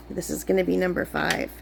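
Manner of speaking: ordinary talk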